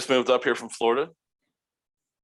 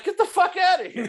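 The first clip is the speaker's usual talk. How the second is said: mock disbelief; 3 dB louder than usual. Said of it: "And I'm like".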